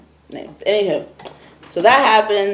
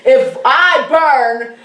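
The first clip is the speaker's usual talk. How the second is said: laughing dramatic threat; 5 semitones higher, much slower than usual. Retold or imitated. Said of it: "she's like".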